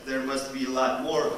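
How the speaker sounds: normal talking voice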